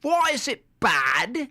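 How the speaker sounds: high, slow mock British accent; slow high British put-on. Shown as a demonstration. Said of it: "How's that? Is that better?".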